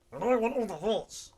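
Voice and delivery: high, loud, bratty demand; playful greedy squeal; mock diva. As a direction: demand it like a spoiled star.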